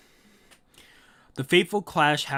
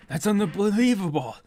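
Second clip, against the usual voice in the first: hammed-up Japanese accent, pitched higher; 5 semitones higher, 3 dB louder than usual. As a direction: react in a put-on Japanese accent. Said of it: "I went with a Japanese accent".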